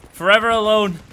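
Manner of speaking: high, loud melodramatic sigh; mock lament. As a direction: wail it like a lonely meme. Mock lament, echoing himself.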